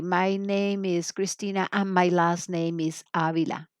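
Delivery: slow, clear example sentence; careful teacher demo; slow model answer. Shown as a demonstration. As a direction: model the answer slowly for the class. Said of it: "And then you're going to say".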